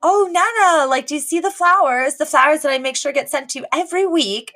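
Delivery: a grown man fishing for praise; hammed-up high eager son; eager Elton. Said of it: "he's like".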